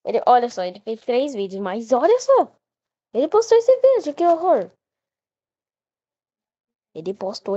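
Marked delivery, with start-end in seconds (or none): 1.9-4.7 horrified. Real, not acted. Reacting to creepy video.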